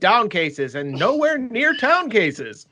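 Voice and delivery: slow, high sing-song rhyme, laughing; Dr. Seuss rhyme. Pretend. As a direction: chant it like a Dr. Seuss verse. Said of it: "You sound like Doctor Seuss".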